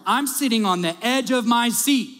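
ordinary delivery

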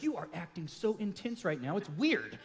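softly voiced, level reproach; hushed mock telling-off; imitated rebuke. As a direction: quote the wife quietly calling it out. Wife's retold rebuke to intense husband.

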